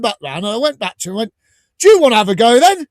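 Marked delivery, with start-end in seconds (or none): 1.8-2.9 mock challenge. Confronting a heckler at gig.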